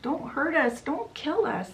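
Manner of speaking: high-pitched, mildly acted begging; retold pleading yell. Daughter begs mother for their lives, high mock plea. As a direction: beg urgently up high without real volume. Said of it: "Gothel yells at her mother".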